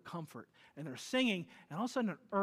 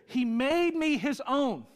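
loud, slow declaration of Paul; emphatic quote; 6 semitones higher, 6 dB louder, slower than usual. Acted. Preacher booming Paul's words to the church, loud and slow. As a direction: proclaim it like Paul himself.

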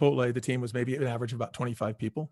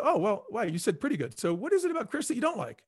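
mock probing; 8 semitones higher than usual. Interviewer probing the employee.

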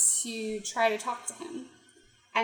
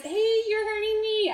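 pained protest; 10 semitones higher, 4 dB louder than usual. Retold or imitated. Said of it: "And he says".

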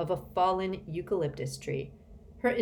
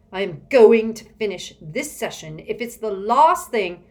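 loud, raised-pitch determination; exasperated; 4 semitones higher, 11 dB louder than usual. A narrator's reading. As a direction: insist forcefully over the interruption. Villain vows to continue, irritated.

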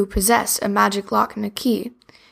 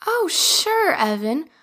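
high, bright shopkeeper; cheerful doll voice; 6 semitones higher than usual. Acted. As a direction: greet him like a chirpy doll. Betty Barbie the baker.

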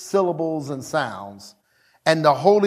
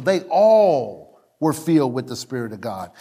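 usual speaking voice

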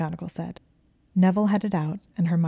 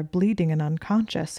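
ordinary delivery

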